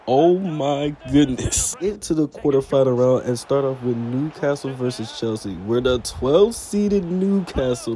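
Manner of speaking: excited play-by-play. Acted. Narrating penalties and golden goals to viewers, excited play-by-play. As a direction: call the goals like a live commentator. Calls the simulated matches.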